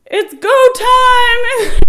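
long, high, full-volume shout; yelling. Retold or imitated. Husband rushing into maternity ward, re-enacted drawn-out yell. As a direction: holler it drawn out across the room. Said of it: "And Sean's yelling".

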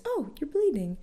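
high, lilting mock-sweet nurse voice; sing-songy nurse. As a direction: imitate a cheery nurse singing the words. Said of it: "adorable kind of sing-songy voice".

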